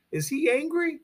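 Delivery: a leading rhetorical question sung up high; mock-innocent question. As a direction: toss the question up high, teasing the listeners.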